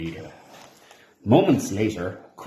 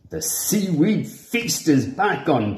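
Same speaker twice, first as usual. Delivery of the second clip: slow, higher cheer; 7 semitones higher, slower than usual. A narrator's reading. Barnacles announcing the feast is saved, slow joyful cheer. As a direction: cheer it out with joy. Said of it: "cheered Barnacles".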